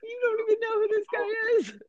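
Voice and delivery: half-laughing shriek of disbelief. Retold or imitated. The host retelling Dan Aykroyd snapping his fingers, squealing with laughter.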